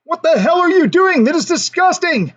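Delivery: high, outraged yell at a friend; angry shout. A narrator's reading. Two friends catching their buddy behind a bush, outraged yell. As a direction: shout your disgust at him. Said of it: "they angrily shout".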